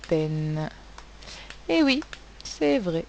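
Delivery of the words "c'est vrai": ordinary delivery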